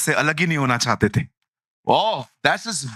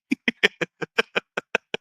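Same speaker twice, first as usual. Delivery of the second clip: a short real laugh; short chuckle; 3 semitones higher than usual. Real, not acted. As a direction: laugh at the joke. Laughs at own joke.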